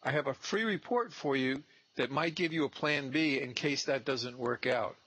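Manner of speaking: high, sweet greeting, slowing at the end; bright door-knock pitch. Shown as a demonstration. Intern pitching a listed seller at the door, high and sweet. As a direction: sell the plan B politely with a smile. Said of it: "I go".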